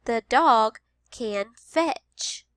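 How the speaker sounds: very slow The dog can fetch; slow teacher read-aloud; slow modeled sentence. Shown as a demonstration. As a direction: read it slowly. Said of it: "Listen to this sentence".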